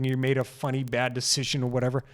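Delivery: ordinary delivery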